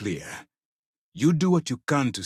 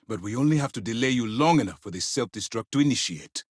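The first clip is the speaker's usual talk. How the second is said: normal talking voice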